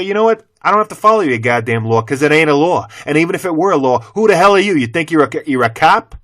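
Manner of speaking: loud, high confrontational challenge; retold angry confrontation. Vlogger retells confronting a clerk over the mask rule, loud challenge. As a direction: get in the clerk's face.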